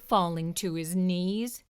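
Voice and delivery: plain reading voice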